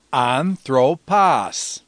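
anthropos drawn out as an, thro, pos; three syllables. Shown as a demonstration. Teacher showing three syllables, anthropos slowly broken into an-thro-pos. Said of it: "three syllables".

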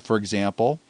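ordinary talk

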